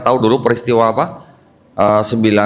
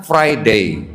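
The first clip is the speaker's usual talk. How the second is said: "Friday" the English way, ending in "day"; British-English "Friday" with an "ey" ending; English pronunciation. Shown as a demonstration. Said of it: "Orang Inggris ngomongnya". ("Friday" logat Inggris, berakhir "dei")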